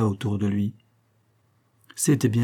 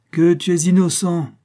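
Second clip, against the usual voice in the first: mocking sneer, pitched higher and louder; 7 semitones higher, 6 dB louder than usual. A narrator's reading. Police chief sneers at the prisoner, mocking laugh in voice. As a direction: scoff at his oath of innocence. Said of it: "ricana le chef de la sûreté".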